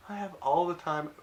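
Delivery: high, loud mock eagerness; imitating an over-eager friend; suddenly eager. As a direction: jump in, thrilled to be asked. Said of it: "Robbie goes from a very serious, like, work face to".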